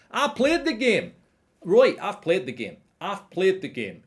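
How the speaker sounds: high, loud mocking rant, stress on played; mock a pundit ranting into his mic; ranting Souness impression. Vlogger mocking a ranting pundit, high loud rant. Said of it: "he's ranting with a".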